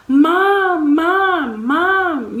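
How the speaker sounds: high, loud drawn-out call for Mom; re-enacted yelling for help; retold yell. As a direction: call out for your mother as if she's in another room. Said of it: "trying to yell out and call her".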